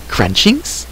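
high, hopeful creature's question; brightened creature. A narrator's reading. Creature hoping Terran offers food, brightened. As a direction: perk up with a rising pitch. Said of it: "Gurgi brightened".

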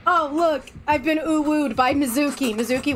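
ordinary talk, nothing marked

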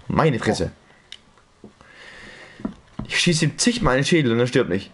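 usual speaking voice

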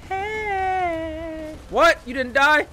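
slow, high sing-song hail; playful calling out. Pretend. Streamer hailing an enemy Max, playful high call. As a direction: stretch it into a long high squeal.

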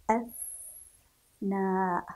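"أثناء" drawn out very slowly, the ث held with a stop; slow model word. Shown as a demonstration. Teacher shows the silent ث to children, slowly. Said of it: "لاحظوا كيف نطقنا حرف الثاء".

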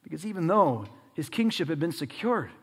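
ordinary delivery

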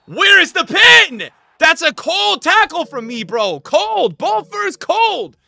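high, loud shout of disbelief; shouting protest. Real, not acted. Gamer shouting that his tackle was clean, loud protest.

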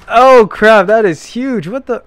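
high, loud astonished exclamation; shocked outburst. Real, not acted. Player seeing a huge stair set, shocked outburst. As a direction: blurt it out in sheer disbelief.

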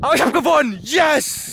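high, excited cry of victory; triumphant cheer. Real, not acted. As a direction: crow about winning. Celebrates winning the challenge.

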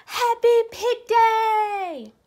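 high, loud, slow triumphant cry; ecstatic shout. Acted. Pig shouting the holiday name to her friend, overjoyed. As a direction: belt it out with pure joy. Piggie announces the big day.

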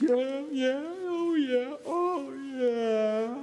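high, wailing imitation of sobbing; mock crying. Lady hearing Bible verses, mock tearful wailing. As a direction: blubber along in exaggerated agreement. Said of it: "Just crying the whole way through".

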